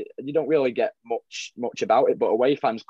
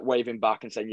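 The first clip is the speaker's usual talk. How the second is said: ordinary talk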